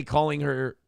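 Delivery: ordinary talk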